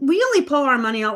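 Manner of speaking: ordinary talk, nothing marked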